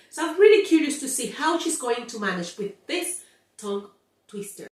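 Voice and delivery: usual speaking voice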